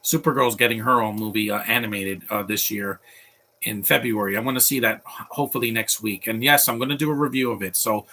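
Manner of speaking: ordinary talk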